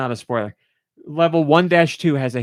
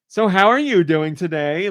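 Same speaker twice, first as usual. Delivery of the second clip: "So how are you doing today?" in a lilting cadence, pitch raised; imitated flower cadence; 4 semitones higher than usual. Talking flower greeting the player, "So how are you doing today?" in lilting cadence. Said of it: "Like, in that cadence".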